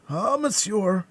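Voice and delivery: high, frightened address; terrified servant. A narrator's reading. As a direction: gasp to your master in fright. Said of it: "stammered Planchet, more dead than alive".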